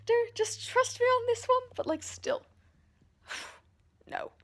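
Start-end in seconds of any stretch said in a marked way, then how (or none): 0.0-1.7 imitating Teresa. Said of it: "she's like".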